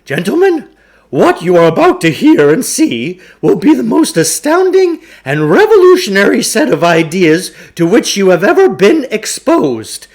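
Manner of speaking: grandiose huckster. Acted. Huckster opening his lecture to two boys, grandly booming. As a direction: oversell every word like a snake-oil salesman.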